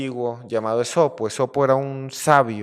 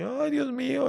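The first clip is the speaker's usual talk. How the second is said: high mock wail of a crying lion; 13 semitones higher than usual. The trapped lion cries out in despair, hammed-up wail. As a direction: wail like a big lion bawling. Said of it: "Y empezó a llorar así".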